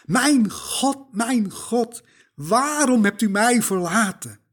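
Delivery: Jesus on the cross crying out, anguished and loud. Acted. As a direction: cry it out to God in anguish. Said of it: "schreeuwde hij het uit".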